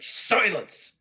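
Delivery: sudden high, loud command; commanding shout. Acted. Klingon silencing the woman mid-plea, commanding shout. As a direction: cut them off with a shout.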